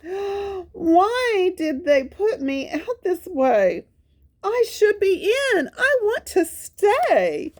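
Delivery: the dog, put out of the zoo, asking why in a high sad voice; sad Spot. Acted. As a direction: ask why in a high hurt voice. Spot after being put out.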